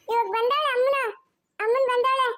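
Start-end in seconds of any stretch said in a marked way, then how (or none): none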